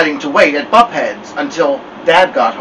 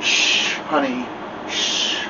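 soft whispered shushing; hushing whisper; 2 semitones lower, 8 dB quieter, slower than usual. Acted. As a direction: hush a crying child gently. Mother hushing crying child.

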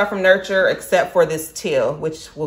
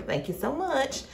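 ordinary delivery

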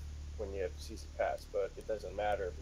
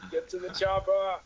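action movie quote; 9 semitones higher, 6 dB louder than usual. Pretend. Movie quote after PTSD joke.

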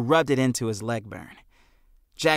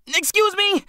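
much higher, louder urgent cry; cried out; 4 dB louder than usual. A narrator's reading. Jack cries out dodging through the crowd. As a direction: call it out while dashing past. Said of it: "Jack cried".

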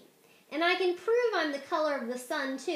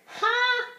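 gloating ha; 10 semitones higher, 7 dB louder than usual. Acted. Orange gloats over Yellow.

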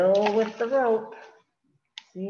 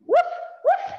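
very high yappy puppy bark; puppy woof; 4 dB louder than usual. Acted. Reading to a child, high puppy woof. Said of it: "my woof is a little too high-pitched".

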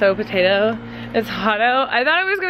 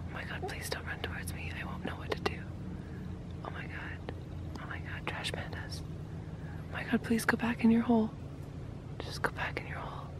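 very soft, real whisper; hushed so as not to startle animals; nervous whisper; 18 dB quieter than usual. Real, not acted. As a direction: whisper it, half scared and half delighted. Whispering near the raccoons.

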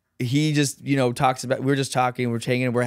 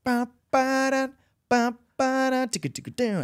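scat the horn line coming down the stairs; trumpet scat; 14 semitones higher than usual. Retold or imitated. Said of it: "the first thing I heard was".

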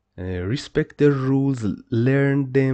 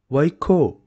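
ordinary talk, nothing marked

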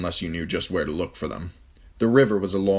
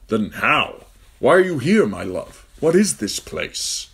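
bewildered; 7 semitones higher, 7 dB louder, slower than usual. A narrator's reading. Ned bewildered questions.

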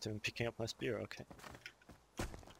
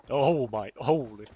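loud startled yell, words cut off; 13 dB louder than usual. Real, not acted. Player under sudden fire yells out in shock. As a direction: yelp in shock as the attack lands.

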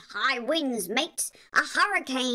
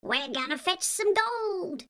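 put-on rhythmic chant for kids; sing-song read-aloud. A narrator's reading. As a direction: bounce it like a nursery rhyme.

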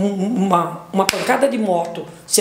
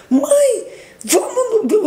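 urgent call; 13 semitones higher, much slower than usual. Retold or imitated. Daughter calls out to mother, retold.

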